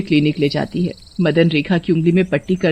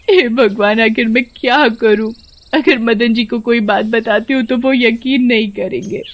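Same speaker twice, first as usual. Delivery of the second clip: higher-pitched, sobbing plea to God; tearful lament; 4 semitones higher than usual. Acted. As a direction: cry through the words, acting a helpless daughter-in-law. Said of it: "रोते रोते बोलती है". (रोते-रोते दुखभरी फ़रियाद)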